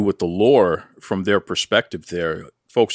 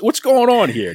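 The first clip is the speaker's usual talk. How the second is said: higher, louder mock interrogation; mock suspicion; 12 semitones higher, 4 dB louder than usual. Real, not acted. Host grilling the wrestler about his accent, mock suspicious. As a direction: play the suspicious detective.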